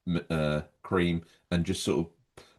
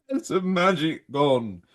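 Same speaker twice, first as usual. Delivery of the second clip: dramatic lament; 8 semitones higher, slower than usual. Acted. Mock dramatic presenter lament.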